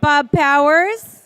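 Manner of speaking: loud, stretched-out name call. Real, not acted. Host calling a missing guest to the seats, calling out. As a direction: summon someone across a noisy room. Calling names to seat people.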